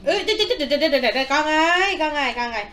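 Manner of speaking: high, loud laughing alarm; laughing exclaim. Real, not acted. Baby grabs food, mother laughs.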